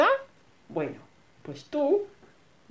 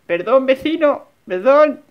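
louder sheepish sorry; loud apology; 6 semitones higher, 11 dB louder than usual. Real, not acted. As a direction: call out sorry to neighbour. Caught by neighbour.

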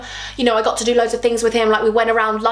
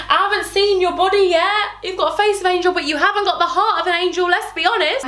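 high-pitched, sassy acted comeback; sassy retort; 8 semitones higher than usual. She answers the bragging boyfriend, sassy retort. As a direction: put him down in a raised pitch.

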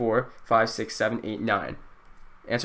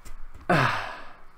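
long, defeated exhale; heavy sigh; 5 dB quieter than usual. Real, not acted. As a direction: let out a deflated sigh. Sigh after time ran out.

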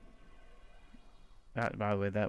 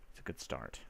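whispered aside; 14 dB quieter than usual. Real, not acted. Whispered then repeated.

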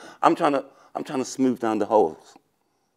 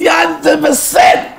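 much higher, loud and slow whoop; gleeful shout; 8 dB louder, much slower than usual. Real, not acted. Teasing his date with a loud, slow, gleeful yell. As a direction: cheer it out like a win. Cheering about expecting sex.

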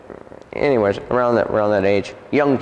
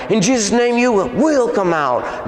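high, forceful retold command; commanding the demon; 9 semitones higher than usual. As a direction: re-enact casting it out, pitched up and insistent. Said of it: "I go".

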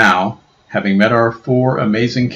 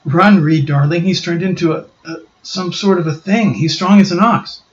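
louder, higher panicked warning; crying out to save him; frightened Susan; 9 semitones higher, 5 dB louder than usual. Acted. Susan warning Reed of the Thing, panicked and loud.

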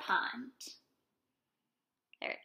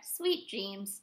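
low, quiet little farewell; soft goodnight reply; 4 semitones lower, 7 dB quieter than usual. A narrator's reading. Little Bird answers Bear before napping, soft goodnight. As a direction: answer softly, settling into sleep. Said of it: "chirped Little Bird".

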